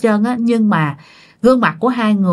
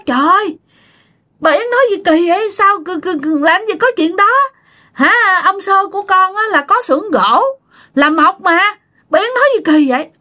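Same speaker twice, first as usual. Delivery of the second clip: much higher, stammering in shock; shocked disbelief; 10 semitones higher than usual. Retold or imitated. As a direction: blurt it out, stunned and protesting, much higher. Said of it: "ông nội hết hồn nói". (hốt hoảng không tin, giọng cao hẳn lên)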